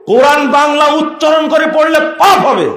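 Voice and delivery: কোরআন বাংলা উচ্চারণ করে পড়লে পাপ হবে shouted high, পাপ hit hard; shouted warning. Real, not acted. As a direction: thunder the warning at the crowd. Preacher repeats warning shouting. (চিৎকার করে সতর্কবাণী, পাপ শব্দে জোর)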